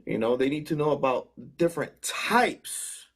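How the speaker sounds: normal talking voice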